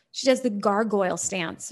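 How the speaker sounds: usual speaking voice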